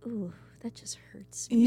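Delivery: breathy whisper of disgust; whispered wince. Real, not acted. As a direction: whisper it like it pains you. Whispered wince at gross idea.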